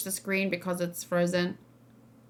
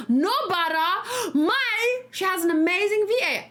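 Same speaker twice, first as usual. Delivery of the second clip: excited astonishment; 9 semitones higher than usual. Real, not acted. Streamer discovering the voice actor's other characters, thrilled and astonished. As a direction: gasp and exclaim each name, pitch soaring.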